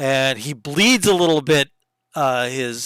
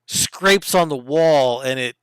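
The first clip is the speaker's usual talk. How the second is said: ordinary talk, nothing marked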